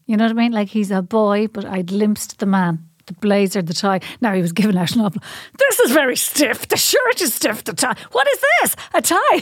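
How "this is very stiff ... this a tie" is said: mock indignant son. Son complaining about stiff uniform.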